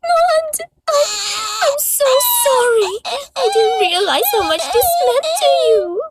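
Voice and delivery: high, crying, pleading sorry; sobbing high apology; tearful apology. Acted. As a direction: apologize through tears to her friend. Tearful apology to her friend.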